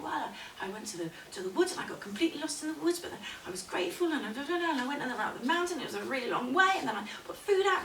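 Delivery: fast, high, rambling; flustered recounting. Acted. Woman telling the crone what she has been doing, flustered. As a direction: gabble through the whole journey.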